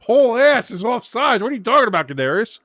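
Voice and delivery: high, loud mock argument with the referee; mock ref argument. Voicing a player yelling at the referee, high and loud.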